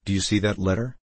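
plain reading voice

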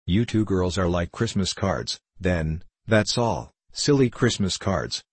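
ordinary delivery, nothing marked

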